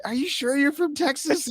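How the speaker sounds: high teasing question; lightly mock the doubting fan. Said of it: "And they're like".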